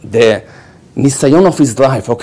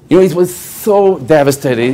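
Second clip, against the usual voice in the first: ordinary talk, nothing marked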